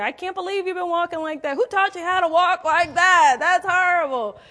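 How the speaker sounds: high, loud berating; mock scolding. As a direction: beat them over the head with it. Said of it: "didn't beat me up over the head and say".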